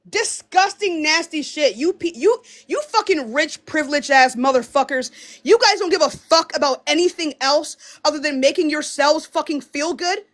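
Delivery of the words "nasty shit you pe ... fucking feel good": ordinary talk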